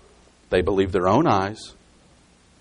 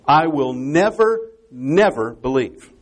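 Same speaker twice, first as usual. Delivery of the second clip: slow "never", a beat, then stressed "never" once more; emphatic double never; 2 semitones higher, slower than usual. Retold or imitated. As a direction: lean hard on both "never"s, drawing them out. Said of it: "It's an emphatic negative".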